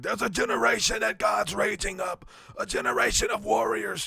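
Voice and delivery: Lou Engle impression. Preacher prophesying over kids at camp, high and shouting. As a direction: proclaim it like a revival preacher. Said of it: "And he did that".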